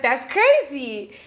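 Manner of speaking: excited quote. Retold or imitated. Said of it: "And I was like".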